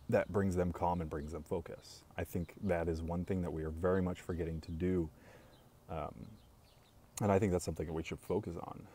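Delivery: ordinary delivery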